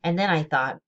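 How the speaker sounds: ordinary delivery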